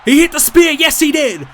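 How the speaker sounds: loud, high shouted play-by-play; shouting commentator. Real, not acted. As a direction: roar the spear call at full voice. Spear hits during live call.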